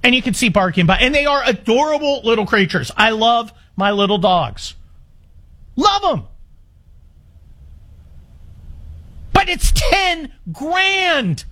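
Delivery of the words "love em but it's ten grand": slow and high, wincing; pained. Real, not acted. Host admitting to listeners the cost stings, pained. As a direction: sigh it out like it hurts.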